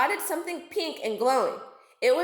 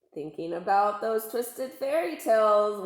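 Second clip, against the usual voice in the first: plain reading voice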